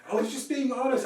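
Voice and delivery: high, loud tearful protest; hammed-up imitation of her wailing; mock crying. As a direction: plead in a put-on wail. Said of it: "She starts crying".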